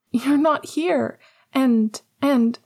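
high, tearful complaint. A narrator's reading. Lily in labour to her absent husband, tearful. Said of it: "Lily's voice was tearful".

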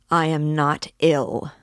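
loud, slow denial; emphatic cry. A narrator's reading. Sick Raskolnikov contradicting Luzhin, crying out slowly. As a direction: cry it out word by word. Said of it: "cried Raskolnikov".

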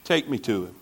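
Jesus answers Mary softly, low and tender; gentle low voice. Retold or imitated. Jesus answering grieving Mary at his feet, low and gentle. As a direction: say it low and soft, lightly voiced. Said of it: "what Jesus tells Mary".

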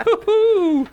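very high whooping cheer. Real, not acted. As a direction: whoop in triumph. Cheering after joke.

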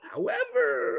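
very high, drawn-out parody; exaggerated high mimicry of a hedge; mock qualifying. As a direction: stretch it out high in a put-on voice. Parodies people explaining they are normal.

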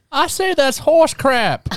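high, slow put-on character voice. Son showing off a voice to guests, high drawn-out character. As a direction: declaim it slowly as a high-pitched comic character. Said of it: "This is the voice of a character I play".

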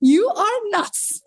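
high, slow words after a laughing fit; laughing disbelief. Retold or imitated. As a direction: voice her thought through leftover laughter. Laughs while voicing woman's thought.

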